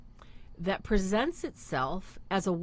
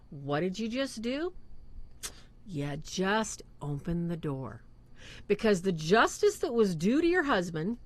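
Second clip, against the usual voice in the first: ordinary delivery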